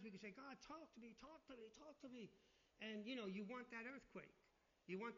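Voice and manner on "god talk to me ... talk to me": higher, softer repeated plea; mock pleading. Believer begging God to speak, mock pleading. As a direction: beg in a small, high voice.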